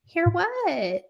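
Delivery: high-pitched puzzled mom; lilting mock-mom question; mother's reply. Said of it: "And she's like".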